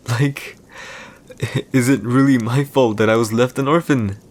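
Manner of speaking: losing composure. Acted. Emperor to his captive wife about being scorned as an orphan, losing composure. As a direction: protest with mounting hurt, voice climbing. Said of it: "I lost my composure there".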